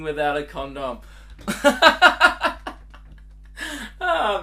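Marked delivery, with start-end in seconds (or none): 1.3-2.7 loud high cackle; real giggle fit; high burst of laughter. Real, not acted. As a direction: break into helpless laughter. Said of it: "laugh at shit for no reason".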